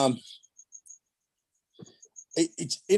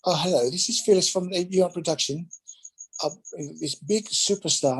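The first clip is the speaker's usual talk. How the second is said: put-on higher, prim phone voice of an old lady; 6 semitones higher than usual. Phyllis phoning the nightclub for him, in a higher, prim voice. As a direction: play the office lady calling a club. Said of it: "She called".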